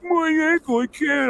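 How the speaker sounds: high, pitiful mock whine; whining. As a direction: whimper it like a mocked, helpless soldier. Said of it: "he's, like, whining".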